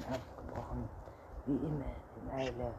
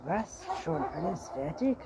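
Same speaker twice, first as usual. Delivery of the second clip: hammed-up, higher-pitched amazement from a father; badly faked astonishment; 5 semitones higher, 6 dB louder than usual. A narrator's reading. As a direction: exclaim with mock surprise, pitched up. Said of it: "rief der Vater mit schlecht gespieltem Erstaunen". (schlecht gespieltes Erstaunen)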